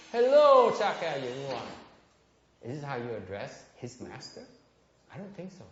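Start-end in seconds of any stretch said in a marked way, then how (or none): 0.1-1.6 much higher and louder hello; mock loud hello. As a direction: shout the greeting mockingly. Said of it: "look how it started". (la lớn lời chào chế giễu)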